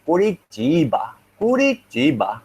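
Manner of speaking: "Curitiba" with CH for the T, like koo-ree-CHEE-ba; palatalized T. Shown as a demonstration. Teacher giving a Portuguese example, "Curitiba" with a CH sound. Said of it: "An example of palatalization in Portuguese".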